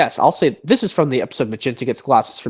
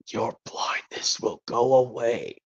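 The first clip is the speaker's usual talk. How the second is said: slow breathy whisper, masked bounty hunter; hushed whisper behind a helmet; disguised bounty hunter whisper; 3 semitones lower, 8 dB quieter, slower than usual. Acted. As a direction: whisper it low and secret like a hidden rescuer. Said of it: "another impression from this movie".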